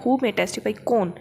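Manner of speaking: ordinary talk, nothing marked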